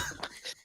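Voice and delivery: short laugh after the yell; burst of laughter. Real, not acted. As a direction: laugh at his own impression.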